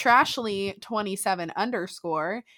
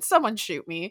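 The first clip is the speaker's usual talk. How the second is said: usual speaking voice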